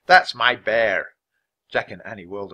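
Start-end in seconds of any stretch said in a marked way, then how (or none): 0.1-1.1 loud, slow, emphatic claim; irate owner's drawn-out shout; angry shout. A narrator's reading. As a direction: stretch each word out in angry protest. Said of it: "someone shouted angrily".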